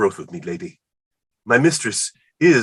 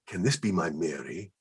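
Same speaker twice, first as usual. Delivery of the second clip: usual speaking voice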